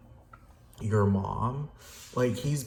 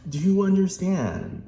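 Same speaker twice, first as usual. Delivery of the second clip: demanding do you understand, pitched up; shout; 9 semitones higher than usual. Real, not acted. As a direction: yell it at them. Yelling at the listener.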